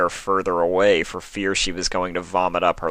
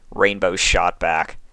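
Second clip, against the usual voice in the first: ordinary delivery, nothing marked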